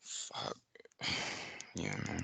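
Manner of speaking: very soft, low, sighing under the breath; real stunned dismay. Real, not acted. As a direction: swear quietly to yourself, heavy sigh. Just got bad news about friend.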